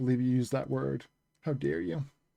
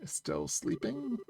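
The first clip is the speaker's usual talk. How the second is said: teasing sleepy question; 9 semitones higher, 8 dB quieter, slower than usual. Acted. Reading game character's line.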